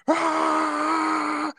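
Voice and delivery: high hammed-up yell imitating the gunner; mock battle cry. Imitating the gunner's yell as he grabs the gun. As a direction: let out a cartoon war cry. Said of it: "and he's like".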